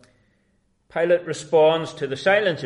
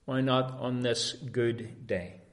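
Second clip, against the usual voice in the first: ordinary talk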